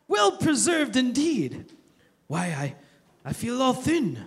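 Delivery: put-on a lightly done old English hobbit voice, wistful; tired old Bilbo in a half-hearted British accent; attempted British accent. Preacher quoting Bilbo to Gandalf, in a mild British accent. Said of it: "I'm not very good at accents".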